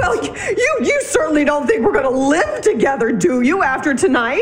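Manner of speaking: crying. Acted. Wife telling husband they cannot live together, crying. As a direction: choke on the first words, crying.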